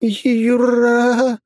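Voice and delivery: terrified cry, voice cracking; breaking voice. A narrator's reading. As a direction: cry out in fright. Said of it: "срывающимся голосом прошептала".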